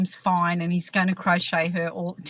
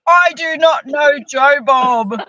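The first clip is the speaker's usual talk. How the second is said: mock announcement; 4 semitones higher, 7 dB louder, slower than usual. Mock disclaimer banner read.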